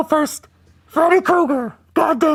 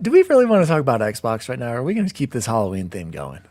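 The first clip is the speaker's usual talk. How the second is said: put-on creepy old man voice; 13 semitones lower, faster than usual. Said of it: "keep doing my voice".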